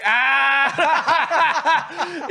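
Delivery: high, loud laughing whoop; laughing yell. Retold or imitated. Walking past his brother's massage room, whooping with laughter. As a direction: crack up loudly at him.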